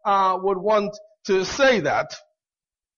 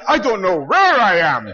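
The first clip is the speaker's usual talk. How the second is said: loud, exaggerated cry of being lost; mock bewilderment; 7 dB louder than usual. Visiting preacher thinking "I don't know where I am," comically bewildered. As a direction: play up the confusion, loudly.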